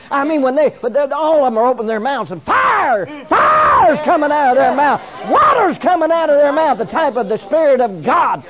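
high, loud revival preacher's shout; full-force preaching. Real, not acted. Preacher prophesying to the dry churches, full-force preaching. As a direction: cry it out like a prophecy to a packed church.

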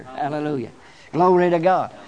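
normal talking voice

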